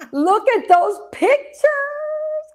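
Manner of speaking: delighted giggly squeal; laughing delight. Real, not acted. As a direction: laugh through the words, drawn out. Delighted by old photos.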